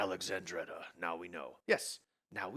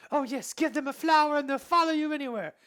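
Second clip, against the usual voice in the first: Elsa voice; 10 dB louder than usual. Acted.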